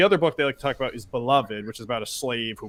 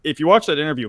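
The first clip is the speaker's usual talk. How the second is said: ordinary talk, nothing marked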